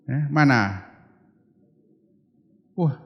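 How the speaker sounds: ordinary talk, nothing marked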